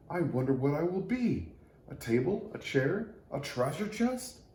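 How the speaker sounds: rising with each guess; excited wondering. A narrator's reading. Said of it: "wondered Little Tree".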